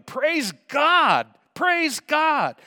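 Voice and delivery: loud, high joyful shout of praise. Retold or imitated. Healed man shouting at Jesus' feet, loud joyful praise. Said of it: "he was shouting".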